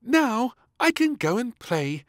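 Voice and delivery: put-on a relieved little girl off to play; happy little princess. Princess free of beans, happy. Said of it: "she said happily".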